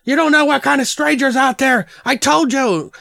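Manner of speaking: high-pitched, hammed-up scolding; mock screaming dad. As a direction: imitate a frantic dad yelling. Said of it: "like, screaming at him and shaking him".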